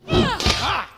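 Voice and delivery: loud, high startled grunt as the blow lands; grunt of impact. Acted. Uncle grunting as the pupil's heavy swing lands. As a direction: cry out as the swing breaks through. Uncle's guard broken by a strike.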